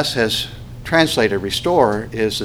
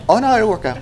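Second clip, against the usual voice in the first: much higher, breezy mock teacher voice; karate teacher; 11 semitones higher, faster than usual. Instructor reassuring a struggling student, in a high mock voice. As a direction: brush off the worry in a high chirpy tone. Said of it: "He goes".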